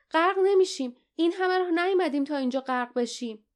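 high, mildly shouted defiance; insistent yell. A narrator's reading. Lief refuses to drown after coming so far, high insistent cry. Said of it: "فریاد زد".

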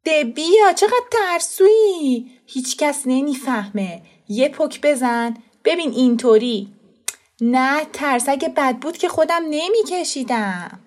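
high, loud mocking child; taunting boy. A narrator's reading. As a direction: tease the coward into it. Boy taunting cousin to smoke. (پسربچهٔ تمسخرآمیز و بلند)

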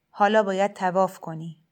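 usual speaking voice

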